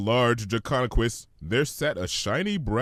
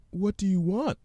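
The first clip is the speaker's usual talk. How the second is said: much higher, gentle pony voice; Fluttershy; 10 semitones higher than usual. Acted. Fluttershy answering the hummingbird, high and gentle.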